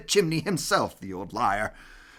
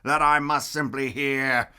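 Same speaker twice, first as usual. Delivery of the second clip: grinning Grinch; 4 semitones lower than usual. Acted. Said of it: "grinned the Grinch".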